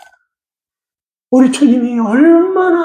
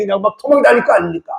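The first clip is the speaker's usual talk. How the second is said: normal talking voice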